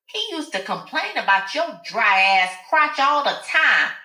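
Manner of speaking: high, taunting brag; bragging Alice. Acted. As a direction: twist the knife. Alice bragging about the affair.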